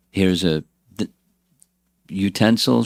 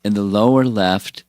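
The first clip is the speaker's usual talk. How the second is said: ordinary delivery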